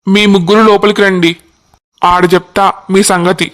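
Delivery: a constable scolding youths for blocking the road; lightly voiced cop laying down the law; stern police constable. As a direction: tell them off with police authority. Constable orders them inside.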